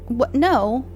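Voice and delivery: ordinary talk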